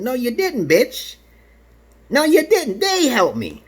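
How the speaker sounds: high-pitched, indignant denial; indignant retort. Retold or imitated. Acted quarrel with his wife.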